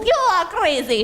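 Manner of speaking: high-pitched indignant cry; acted high screech of protest; outraged villain. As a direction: throw it out high, in character. Villain cries out as plan works.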